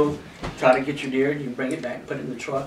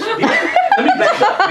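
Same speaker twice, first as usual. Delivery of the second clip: slow, high laughing correction, louder; 6 dB louder, slower than usual. Real, not acted. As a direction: stretch it out high through a laugh.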